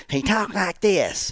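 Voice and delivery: slow, lightly exaggerated Southern drawl; Crazy Bobby. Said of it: "His name was Crazy Bobby".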